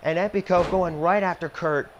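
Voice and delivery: lively play-by-play announcer; wrestling commentator. Acted. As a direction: call the match like a TV announcer. Plays wrestling announcer over game.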